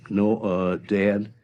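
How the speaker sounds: ordinary talk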